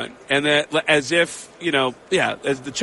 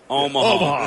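laughing; 10 semitones higher, slower than usual. Retold or imitated. Peyton answering, laughing.

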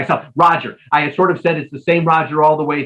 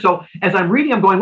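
usual speaking voice

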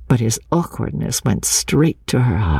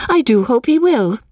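high, raised voice; 12 semitones higher than usual. A narrator's reading. Lucy made reckless by her cousin's look, raised voice. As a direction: lift the pitch right up. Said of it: "she said, raising her voice".

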